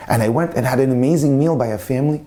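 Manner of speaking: ordinary talk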